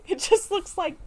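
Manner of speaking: high, loud, bursting with laughter; laughing. Real, not acted. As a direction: laugh through it at the silly name.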